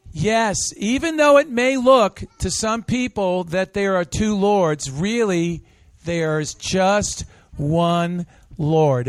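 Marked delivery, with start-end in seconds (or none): 4.9-9.1 each word drawn out, "just" leaned on; teacher modelling the line for a children's group; slow emphatic. Shown as a demonstration. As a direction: spell it out slowly for the kids. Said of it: "Everybody say that".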